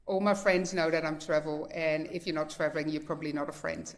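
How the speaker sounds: usual speaking voice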